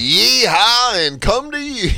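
cowboy yell. Retold or imitated. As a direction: hoot it like a rodeo caller. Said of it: "He goes".

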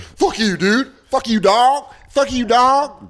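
high-pitched street-slang heckler impression; hammed-up nineties wannabe. Offended crowd member to comic, hammed-up slangy wannabe voice. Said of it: "wigger lady".

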